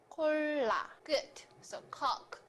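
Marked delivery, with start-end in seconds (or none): none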